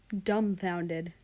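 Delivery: ordinary delivery, nothing marked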